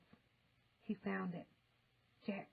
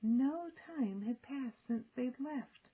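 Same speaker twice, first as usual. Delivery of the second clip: ordinary delivery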